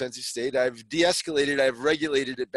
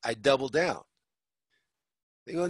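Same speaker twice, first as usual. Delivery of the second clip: ordinary delivery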